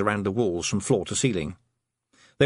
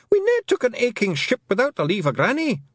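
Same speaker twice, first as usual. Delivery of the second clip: much higher Scottish pixie, caught out; flustered Feegle; 12 semitones higher, 4 dB louder than usual. Acted. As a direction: Rob Anybody squirming under questioning. Rob Anybody protesting.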